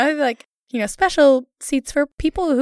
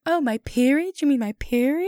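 put-on slightly higher, fancy made-up accent; pompous Renaissance woman; 4 semitones higher than usual. Host showing friend how to refer to it, pompous accent. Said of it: "sound like a pompous Renaissance woman".

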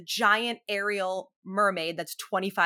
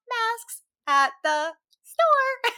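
put-on child's voice; 5 dB louder, much slower than usual. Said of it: "and she'll go".

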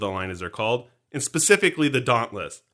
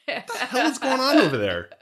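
laughing; 9 semitones higher than usual. Real, not acted. Laughing at co-host's antics.

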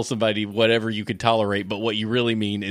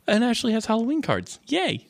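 mock excited gasp; 10 semitones higher than usual. Mimicking cohost's gasp.

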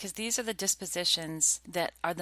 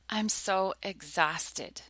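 mock exhausted repeat; much slower than usual. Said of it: "they repeat the phrase".

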